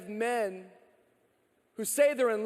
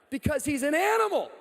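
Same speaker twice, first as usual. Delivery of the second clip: shouted punchline; 6 semitones higher, 4 dB louder than usual. Real, not acted. Punchline about dog Carter.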